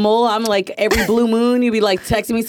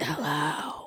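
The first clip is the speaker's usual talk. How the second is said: whispering; 3 semitones lower, 13 dB quieter than usual. Retold or imitated. Mimicking the whispered greeting.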